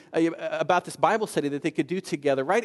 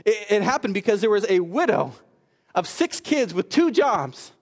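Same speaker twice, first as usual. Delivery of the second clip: raised, punched sermon delivery; emphatic preaching; 3 semitones higher, 3 dB louder than usual. Real, not acted. Preacher credits a widow's courage to the congregation, emphatic preaching. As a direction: drive each phrase home to the congregation. Preacher drives home the point.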